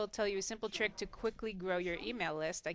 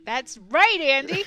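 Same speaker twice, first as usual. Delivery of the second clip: same reply, much louder and higher; louder emphatic; 5 semitones higher, 14 dB louder, slower than usual. Shown as a demonstration. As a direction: punch it out with more energy. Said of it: "give us a little more than that".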